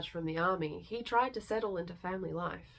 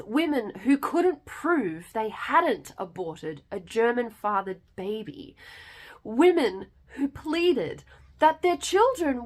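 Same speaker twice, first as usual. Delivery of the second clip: rising from firm to a loud, higher, impassioned cry; building outrage; 3 semitones higher, 9 dB louder than usual. A narrator's reading. Narrator condemning the vengeance on French women, building to loud outrage. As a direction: let the anger swell line by line until it is almost shouted.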